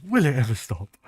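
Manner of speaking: mock exasperated Roy; imitating Roy Kent. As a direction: groan it like a fed-up footballer. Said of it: "And he goes".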